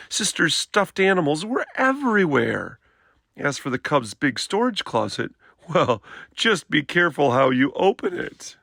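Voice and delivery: ordinary delivery, nothing marked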